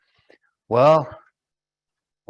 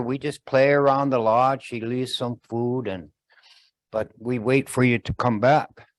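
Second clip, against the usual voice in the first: usual speaking voice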